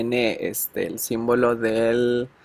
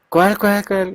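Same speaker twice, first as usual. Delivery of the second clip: mock panic, pitched up high and louder; alarmed; 8 semitones higher, 4 dB louder, slower than usual. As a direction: imitate the friend's sudden alarm, quick and high. Friend panics over missing accent. (el amigo alarmado, imitado con voz aguda y fuerte)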